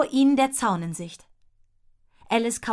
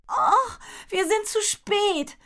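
high, drawn-out cry of dismay; high-pitched, stretched-out lament of a desperate girl; despairing cry; 10 semitones higher, slower than usual. A narrator's reading. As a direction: wail it slowly in despair. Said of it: "rief Rosie verzweifelt".

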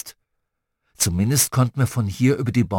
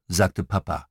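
usual speaking voice

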